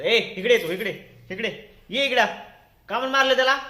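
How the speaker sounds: loud, high summons and challenge; stern scolding. Real, not acted. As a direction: call the culprit over crossly. Parent calls over and scolds child.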